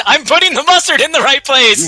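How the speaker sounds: high, loud, mock-heroic declaration; hyped-up boast. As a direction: boast it like a superhero.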